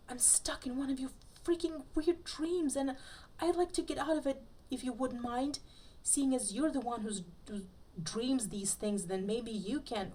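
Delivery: high, rushed panicky pleading; panicked plea. Acted. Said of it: "in a wild panic".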